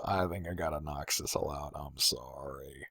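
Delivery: whispered apology. Real, not acted. Knocking out a favourite character, whispering sorry.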